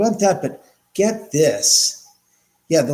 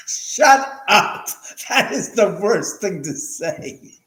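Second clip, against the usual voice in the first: laughing outcry; 6 semitones higher, 5 dB louder, slower than usual. Real, not acted. Laughing outburst at a chat comment.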